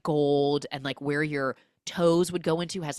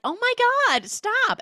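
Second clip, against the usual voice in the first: much higher, louder giggly protest; giddy squeal; 8 dB louder than usual. Retold or imitated. J Lo flattered by the gift, high giddy squeal. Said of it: "She's like".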